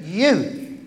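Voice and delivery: "you" stretched out with a swoop in pitch; emphatic YOU. Shown as a demonstration. Preacher showing the congregation the key word, "you" emphasised. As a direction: land hard on a long "you". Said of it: "with the emphasis on the word".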